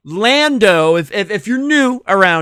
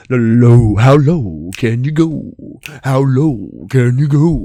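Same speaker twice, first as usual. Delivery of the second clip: much lower, louder low-voice demo; 4 semitones lower, 4 dB louder, slower than usual. Shown as a demonstration. Host showing the low part of a harmony, low and loud. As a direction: drop the voice deep for the low part. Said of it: "you gotta have the high pitch and the low".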